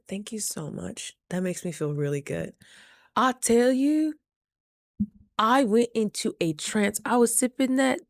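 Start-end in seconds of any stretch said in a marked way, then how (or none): 0.1-2.5 hushed grateful thank-you; soft thanks. Retold or imitated. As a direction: murmur your thanks gently.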